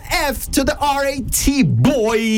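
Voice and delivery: high shouted show intro; radio announcer hype. Acted. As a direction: call it out like an MC. Hyping the guest intro on air.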